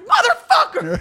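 high, loud, laughing curse; mock angry outburst. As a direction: blurt it out in half-laughing fury. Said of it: "I was like".

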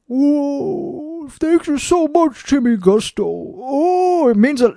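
high, soft, put-on gushing thanks; simpering gratitude voice. Demanding fans thank him, in a mock fawning voice. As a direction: gush like a fawning fan. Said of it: "don't say it in that fucking voice".

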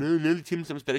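a hint of a whiny Aussie objecting; mocking Australians. Host mocks Australians defending Tim Tams, mock whine. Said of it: "Australians at me going".

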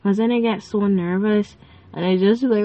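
ordinary talk

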